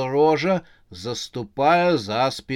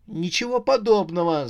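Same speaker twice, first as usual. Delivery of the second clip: higher, mildly raised denial; protesting cry; 5 semitones higher than usual. Acted. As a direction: cry out in protest, not full force. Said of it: "закричала Рожа". (протестующий выкрик)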